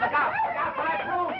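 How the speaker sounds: high-pitched alarmed call; urgent warning shout. Acted. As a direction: call out a sudden danger.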